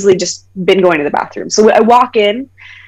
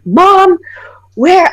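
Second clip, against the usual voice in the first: loud, high yell for a parent; retold furious summons; angry calling out; 10 semitones higher, 6 dB louder than usual. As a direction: shout through the house for Mom. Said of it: "I was so angry".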